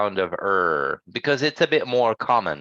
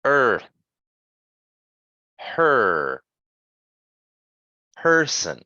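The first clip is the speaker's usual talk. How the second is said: "Earth", "Her", "Person", each er strong and full; stressed er; much slower than usual. Shown as a demonstration. Teacher leading repeat-after-me, "Earth", "Her", "Person" with stressed er. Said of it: "the stress sound is more like this".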